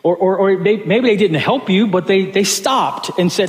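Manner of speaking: ordinary talk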